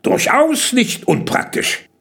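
slow, high, emphatic praise; approving. A narrator's reading. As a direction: repeat the praise with relish. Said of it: "lobte Kalle".

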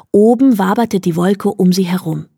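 ordinary delivery

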